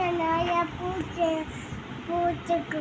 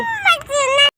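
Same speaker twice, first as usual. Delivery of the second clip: much higher, loud crying call; tearful wail; 8 semitones higher, 9 dB louder than usual. Real, not acted. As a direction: sob out mummy, voice cracking up high. Child asked why she cried.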